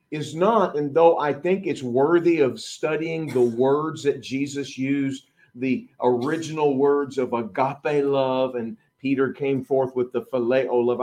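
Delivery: ordinary talk, nothing marked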